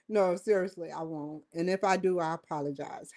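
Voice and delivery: ordinary talk, nothing marked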